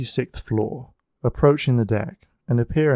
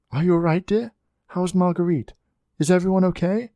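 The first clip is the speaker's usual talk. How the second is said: a mother's voice, higher and tense with worry; worried mother checking in, pitched higher; 8 semitones higher than usual. A narrator's reading. Mother calling her son on the phone, tense and worried. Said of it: "the tense voice of his worried mother".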